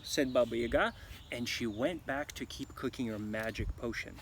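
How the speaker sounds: plain reading voice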